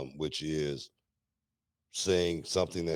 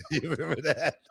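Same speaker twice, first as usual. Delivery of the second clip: asking through real laughter; laughing; 6 semitones higher than usual. Real, not acted. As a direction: giggle the question out. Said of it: "laughing while remembering".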